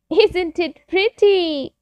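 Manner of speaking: put-on high, slow childlike squeal of admiration; high, drawn-out child voice; children's cry. A narrator's reading. Children seeing the new tree, delighted high cry. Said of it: "cried the children".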